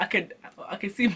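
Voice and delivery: ordinary delivery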